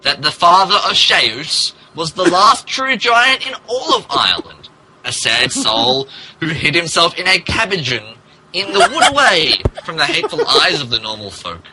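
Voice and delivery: normal talking voice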